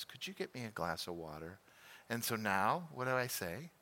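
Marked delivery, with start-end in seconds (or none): 0.0-1.6 soft sweet request. Retold or imitated. Wife asks again sweetly.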